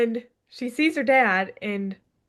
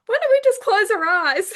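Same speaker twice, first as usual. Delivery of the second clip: higher, louder, bright proposal; eager suggestion; 11 semitones higher, 5 dB louder than usual. Retold or imitated. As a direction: jump on the idea. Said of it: "May's like".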